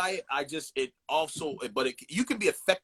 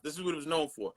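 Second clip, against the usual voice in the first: ordinary talk, nothing marked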